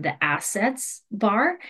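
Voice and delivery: ordinary delivery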